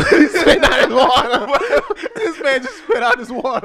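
high, helpless laughing words; tattling on a friend while laughing hard; cracking up. Real, not acted. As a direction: gasp it out through real laughter. Cracking up at friend spitting water.

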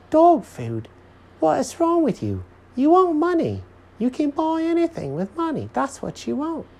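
very high, scolding cartoon voice; exasperated Jenkins character. A narrator's reading. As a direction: scold him in a high, incredulous voice.

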